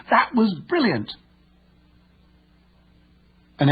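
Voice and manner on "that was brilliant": much higher, slower, thrilled praise; excited. Retold or imitated. Teammate praising a fantastic goal, excited and high. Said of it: "heard to say with excitement".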